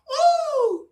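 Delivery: high, drawn-out imitation "oh"; excited recognition. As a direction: coo it high in mock amazement. People recognising him, imitated.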